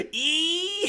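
sudden high squeal; high-pitched excited eek. Retold or imitated. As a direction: squeak with glee like an overexcited kid. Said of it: "so excited to get the walkie-talkie".

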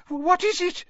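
high-pitched, frightened question; terrified gasp. A narrator's reading. Aunt Petunia backing from the fireplace, gasped in terror. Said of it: "gasped Aunt Petunia".

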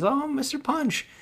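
put-on very high little-girl voice for Judy; girlish voice. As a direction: squeak it out like a small girl. Girl voiced in movie retelling.

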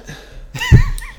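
squeaky rising laugh; high laugh. Real, not acted. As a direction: giggle high. Laughs at own accent.

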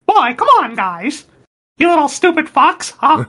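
high-pitched cartoon mouse voice; Mickey Mouse. Messing with brother in the Disneyland line, cartoon mouse impression. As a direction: squeak it out like a cheery cartoon mascot. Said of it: "Mickey Mouse impression".

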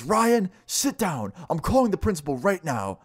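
high-pitched mock teacher command; imitated stern teacher. Teacher grabbing a pupil by the collar, mock stern order.

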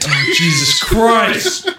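very high, drawn-out shout; exclaiming. Real, not acted. Co-host breaks off his brother story, high drawn-out shout. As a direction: stretch it out into a high yell.

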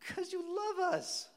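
put-on very high, drawn-out child voice; singsong little-kid answer, slow and high; imitated nonchalant kid.

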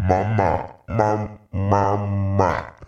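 put-on loud babbling baby voice; crying baby doll. Shown as a demonstration. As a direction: bawl mama like a baby doll. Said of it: "my cry sounded something like this".

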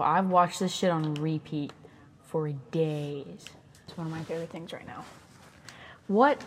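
ordinary talk

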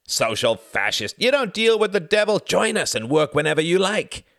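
jeering shout. A narrator's reading. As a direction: hurl the insults across the hall. Communists jeer at union man.

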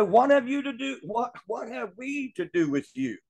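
high-pitched demon's protest, lightly acted; alarmed unclean spirit. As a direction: question it like a rattled demon. Demon's alarmed question to Jesus.

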